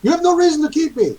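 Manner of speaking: very high, insistent protest; high-pitched prisoner who won't let up; going on and on. Acted. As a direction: keep protesting innocence in a high voice. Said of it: "going on and on about that".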